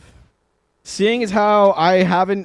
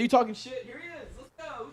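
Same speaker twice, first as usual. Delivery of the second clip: cat squaring up; 9 semitones higher, 11 dB quieter than usual. Pretend. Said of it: "he's like".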